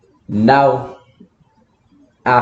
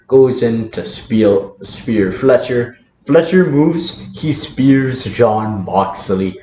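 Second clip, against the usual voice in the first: normal talking voice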